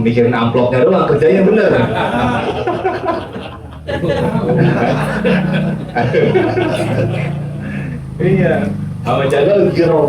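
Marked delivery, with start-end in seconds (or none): 1.8-8.1 hearty laugh. Real, not acted. Laughs at own joke.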